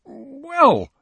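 high, loud startled well; surprised. A narrator's reading. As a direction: catch yourself, startled. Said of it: "recovering quickly from this unpleasant surprise".

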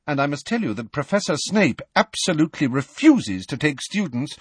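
a hint of a brisk, stern professor. A narrator's reading. McGonagall voiced in story.

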